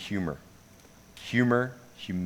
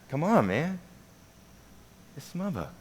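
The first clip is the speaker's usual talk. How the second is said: higher, softer insistence; imitated pleading friend; 6 semitones higher, 7 dB quieter than usual. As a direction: plead gently, dropping to a hushed "smuba". Chris insists, acted.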